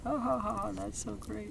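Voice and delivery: soft, very high falsetto giggle-talk; mock little-girl voice, high and light; amused little girl. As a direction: coo it like a tickled child. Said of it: "she thought that was so funny".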